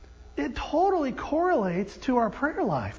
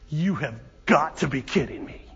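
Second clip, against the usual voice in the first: low, weary exasperation; exasperated; 10 semitones lower, 4 dB quieter than usual. Acted. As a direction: groan it out under your breath in disbelief. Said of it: "with exasperation".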